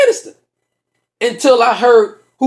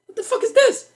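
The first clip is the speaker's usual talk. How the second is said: high-pitched disbelief; 14 semitones higher, 4 dB quieter than usual. Retold or imitated. Mimicking a puzzled look at driver.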